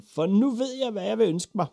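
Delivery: put-on high, loud, excited boy's call; bursting to announce it; eager shout. A narrator's reading. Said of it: "råbte Ivan".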